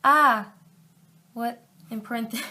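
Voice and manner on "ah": loud short "Ah!" of fright; short startled yelp. Acted. Startled girlfriend yelping at her boyfriend, loud short "Ah!". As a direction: yelp as if grabbed from behind. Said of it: "with a loud and short".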